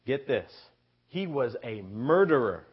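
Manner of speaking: ordinary talk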